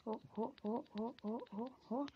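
soft rising whoops; short, quiet "oh" sounds, each sliding upward; string of oh-oh cries. Real, not acted. Reacting to action in game.

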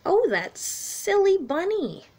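normal talking voice

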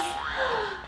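sharp high gasp. A narrator's reading. Rabbit sees the empty patch, high gasp. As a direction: gasp in shock.

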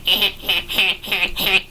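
soft, staccato "heh-heh-heh"; smug mock laugh. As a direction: act out a gloating little chuckle. Mimics own smug laugh at dad.

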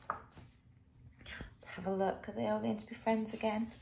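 very soft, hushed aside; whisper, then murmur the question quickly; suspenseful whisper. A narrator's reading. Reader to children wondering about the friends, whispering.

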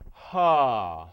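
loud, released, breathy ha; breathy open ha. Shown as a demonstration. Teacher showing students an open, released, breathy ha. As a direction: let the ha fall out open and airy. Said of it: "open, released, breathy".

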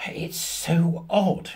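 slow, lingering, puzzled; drawn out slowly. Real, not acted. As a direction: stretch every word of it. Lingers on a strange story.

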